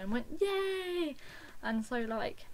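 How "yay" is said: high, drawn-out cheer; excited cheer. Retold or imitated. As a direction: imitate a delighted fan's cheer. Crowd cheers at stage door news.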